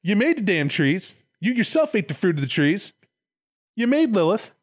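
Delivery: high-pitched, indignant mock accusations; hammed-up incredulous reproach; accusing Lucifer. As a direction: lay out the blame point by point. Acted Lucifer accusing God.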